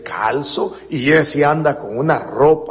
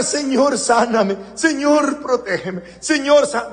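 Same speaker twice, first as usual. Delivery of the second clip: mock begging prayer; 6 semitones higher than usual. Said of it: "Aquí no es de estar".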